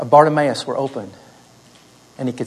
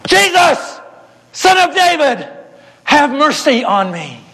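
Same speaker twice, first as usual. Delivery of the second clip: high, loud cry for help across a crowd; a blind beggar calling out to be heard; 12 semitones higher, 3 dB louder, slower than usual. Acted. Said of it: "he called out".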